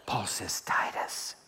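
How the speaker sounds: hushed whisper. Acted. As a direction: lean in and whisper it. Intimate aside quoting Paul.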